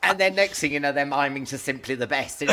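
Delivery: usual speaking voice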